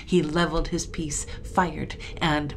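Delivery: ordinary delivery, nothing marked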